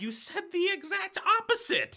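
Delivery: indignant. Acted. Partners bickering about the bus station.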